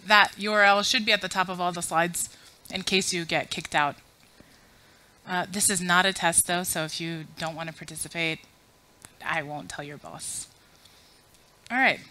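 ordinary talk, nothing marked

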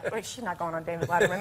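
low, quick, muted aside; skeptical seatmate. Retold or imitated. Seatmate doubts the sobbing girl's claim, low and quick. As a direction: drop the voice and dismiss it fast. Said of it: "and he's like".

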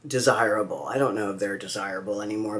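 ordinary talk, nothing marked

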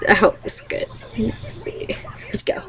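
unvoiced sneaky whisper, very soft; mock prank whisper.

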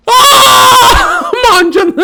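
high, loud startled laugh; shocked laughing shout. Real, not acted. Startled by the creature, she shrieks and laughs.

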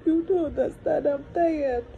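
real crying, tired and broken. Real, not acted. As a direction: cry through the words, worn out. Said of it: "Is this how you continue crying".

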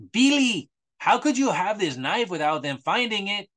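mock Spanish accent. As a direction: ham up a Spanish accent as Paco questioning Billy. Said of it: "use my excellent Spanish accent for this portion".

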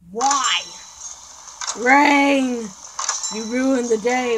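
loud, fed-up cry at the rain; 1 exasperated yell. Real, not acted. Player yelling at game rain, exasperated yell. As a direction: curse the weather out loud.

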